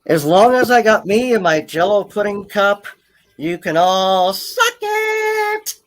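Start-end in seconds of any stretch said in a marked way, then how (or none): 3.8-5.8 singsong taunt. Pretend. Teasing sign-off.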